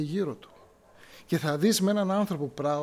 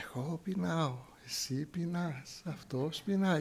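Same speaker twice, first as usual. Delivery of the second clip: calm; 7 dB quieter than usual. Retold or imitated. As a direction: say it serenely, as if nothing could ruffle you. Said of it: "είμαστε όλοι ήρεμοι και μιλάμε ήρεμα".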